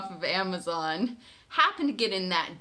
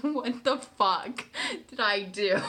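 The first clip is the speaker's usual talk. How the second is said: half-laughing shock; stunned disbelief; 2 semitones higher than usual. Retold or imitated. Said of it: "I was like".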